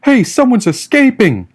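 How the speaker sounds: high, loud alarm call from a distant guard; a guard raising the alarm, yelling high and loud; guard's yell. A narrator's reading. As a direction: shout it down from the wall. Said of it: "yelled a voice".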